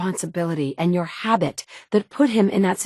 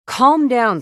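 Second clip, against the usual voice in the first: loud call to calm down; 6 semitones higher, 6 dB louder than usual. Acted. Game dialogue, calming an angry mutant.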